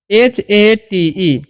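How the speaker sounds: slow, letter by letter; spelling out letters. Shown as a demonstration. Teacher spells out hate for students, slowly. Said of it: "एच का उच्चारण क्या करना है?".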